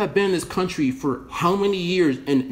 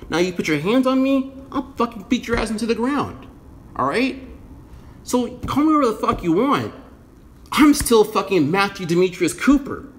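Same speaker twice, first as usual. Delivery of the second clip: heated threat; 6 semitones higher than usual. Real, not acted. Ranting at protesters, warning them not to lay hands on him, raised and angry. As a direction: square up and warn them hard.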